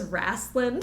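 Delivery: "wrastlin'" with a flat a and dropped g; dad's "wrastlin'" for wrestling. Retold or imitated. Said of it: "My dad always says".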